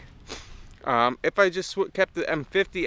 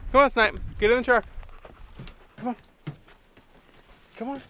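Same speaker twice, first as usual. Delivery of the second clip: calling the dog; 7 semitones higher, faster than usual. Real, not acted. Coaxing dog into the truck.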